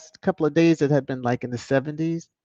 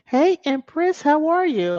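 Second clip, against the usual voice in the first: high sing-song greeting; 12 semitones higher, 3 dB louder than usual. Real, not acted. Greeting a chat viewer by name.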